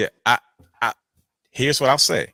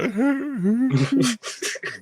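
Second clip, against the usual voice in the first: high fake sob; hammed-up crying sound; mock crying wail; 12 semitones higher than usual. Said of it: "You guys hear my crying voice?".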